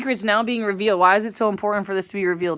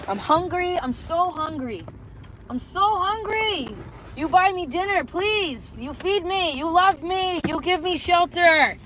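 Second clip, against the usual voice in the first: hammed-up pleading; 6 semitones higher than usual. Streamer begging viewers for dinner and love, mock-desperate.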